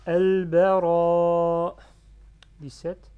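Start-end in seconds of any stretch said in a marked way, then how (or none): 0.1-1.8 AL-BARA very loud, slowly held, cleanly cut off; no final breath. Shown as a demonstration. Teacher demonstrates ending without breath, loud long clean vowel. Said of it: "je sors pas le souffle à la fin".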